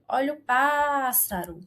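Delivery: "pássaro" with a long, held "pás"; stressed first syllable. Shown as a demonstration. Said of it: "porque a sua antepenúltima sílaba".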